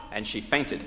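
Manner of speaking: ordinary delivery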